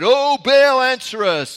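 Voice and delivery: loud, high cry to a silent god; shouting to Baal. Acted. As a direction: call out desperately upward.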